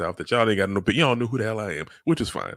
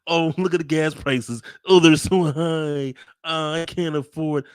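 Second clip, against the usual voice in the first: higher, slow mock whine; 7 semitones higher, 4 dB louder, slower than usual. Host mimicking rich people complaining online, whiny.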